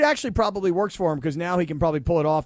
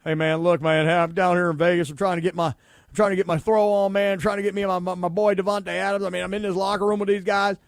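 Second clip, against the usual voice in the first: mock Southern accent, rambling on; Derek Carr; 4 dB louder than usual. Host imitating a quarterback newly arrived in Vegas, mock Southern accent. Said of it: "I don't know why he talks like that".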